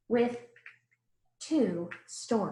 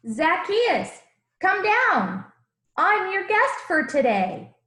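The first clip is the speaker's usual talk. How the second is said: raised, high call up into a tree; 9 semitones higher, 6 dB louder, slower than usual. Acted. Jesus calling up to the man in the tree, loud and friendly. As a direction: call him down from the branches, bright and loud.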